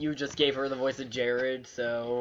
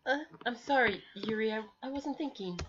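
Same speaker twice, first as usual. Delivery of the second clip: put-on much higher, apologetic anime girl; 9 semitones higher than usual. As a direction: lift into a sorry young girl. Voicing Sayori's apology to Yuri.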